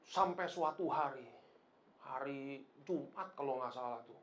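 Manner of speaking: ordinary talk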